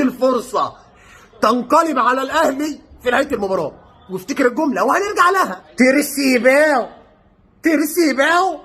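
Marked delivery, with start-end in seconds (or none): none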